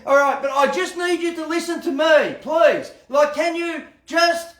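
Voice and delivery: loud, high-pitched pleading demand; an upset person shouting, demanding to be heard. Acted. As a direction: raise your voice like an upset person.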